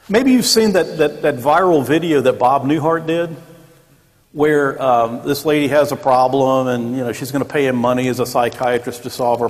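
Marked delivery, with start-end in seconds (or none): none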